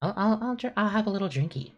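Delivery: high, cutesy little voice. Retold or imitated. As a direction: coo it like a playful kid. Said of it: "I was like".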